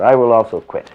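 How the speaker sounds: retold loud retort. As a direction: top the outburst with authority. Guru answering the outburst.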